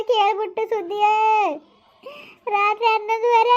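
high, sing-song wailing; keening cry with stretched phrase ends; tearful lament. Acted. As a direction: pour out the news while wailing. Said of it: "ಅಳ್ಬೇಡಿ".

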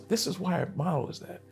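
ordinary delivery

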